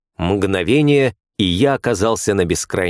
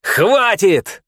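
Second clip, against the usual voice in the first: sharp, much higher shouted command; barked shout; 10 semitones higher than usual. Acted. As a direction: bark it out to stop the storm. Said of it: "рявкнул".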